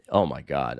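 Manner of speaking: ordinary talk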